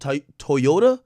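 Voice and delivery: Toyota slowly as "Toy-ota", questioning rise; American pronunciation. Shown as a demonstration. Testing how y'all say it, American "Toy-ota". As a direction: feel out the "oy" that opens Toyota. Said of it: "y'all say it?".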